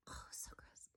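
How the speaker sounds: hushed, grossed-out moan; disgusted whisper. Real, not acted. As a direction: whisper it with a shudder. Whispered revulsion.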